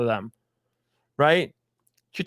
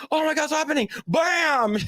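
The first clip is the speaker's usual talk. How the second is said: high-pitched comic alarm, then a punch word; hammed-up startled victim; mock panic; 9 semitones higher than usual. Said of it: "It's like".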